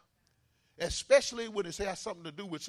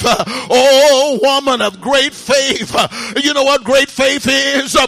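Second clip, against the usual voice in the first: loud, rhythmic sermon delivery with uh breaths; fiery preaching; 4 semitones higher, 20 dB louder than usual. Acted. Preacher urging the church to call on God, fiery preaching. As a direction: preach it hard to the congregation.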